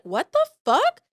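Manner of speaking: a gasp of disbelief, then let it fly high; shocked outburst. Retold or imitated. Retold outrage.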